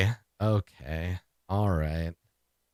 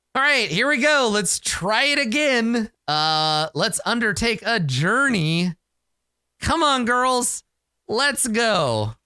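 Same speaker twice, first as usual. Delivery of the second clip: rallying, higher and brighter; hyped up; 7 semitones higher than usual. Real, not acted. Player rallying her companions before a roll, hyped up. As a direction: pump up the party before the roll.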